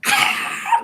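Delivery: sudden high breathy scream; startled scream. Retold or imitated. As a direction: scream in fright. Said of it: "I really screamed".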